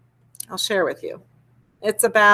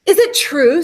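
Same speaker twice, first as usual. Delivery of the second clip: higher, louder, eager questioning; eager princess; 5 semitones higher, 5 dB louder than usual. Acted. Princess flattering the dragon, higher and louder. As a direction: lean in, all flattering curiosity. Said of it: "Elizabeth flatters the dragon".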